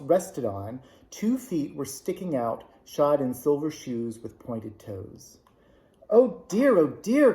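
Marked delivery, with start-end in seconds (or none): 6.1-7.3 put-on much higher and louder, alarmed little girl; cried in dismay. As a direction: gasp it out in sudden dismay. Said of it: "cried Dorothy ... in dismay".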